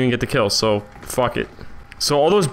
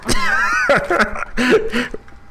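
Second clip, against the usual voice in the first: high shrieking burst of laughter. Real, not acted. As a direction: cackle high at the mess. Big laugh after mutual kill.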